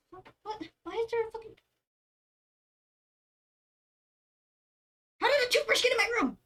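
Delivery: very high-pitched, bewildered questioning; real bafflement, voice shooting way up; baffled disbelief. Real, not acted. Streamer finding a stray toothbrush in their room, squeaking in bewilderment.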